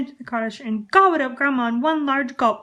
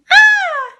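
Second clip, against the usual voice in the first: piercing, much higher, much louder scream; 14 dB louder than usual. Acted. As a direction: scream in terror.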